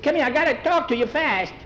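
put-on high, insistent boy's plea; insisting on being heard right away; urgent calling. Skeeter urgently calls Tom over.

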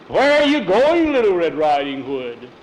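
hammed-up school-play delivery; stagy woodman. Hugo Barnes as woodman greeting Skeeter, stagy hail.

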